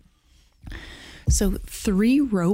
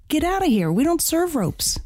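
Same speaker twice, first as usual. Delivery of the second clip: high bartender; 10 semitones higher than usual. Acted. Joke: bartender throws rope out.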